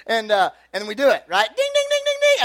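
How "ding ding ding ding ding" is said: very high, loud rapid dinging; doorbell imitation. Kids ringing the cop's doorbell, high frantic dinging. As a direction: mimic a frantically pressed doorbell.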